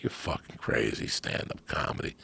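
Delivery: whispered mock disbelief; low whispered scoff; scoffing aside. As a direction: brush it off under your breath. Retold dismissive reply.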